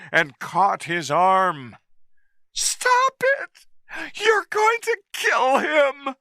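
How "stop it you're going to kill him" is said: shouted. A narrator's reading. Woman pleading with the captain to spare the ape, shrill shout. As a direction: shriek it at the captain. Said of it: "she shouted".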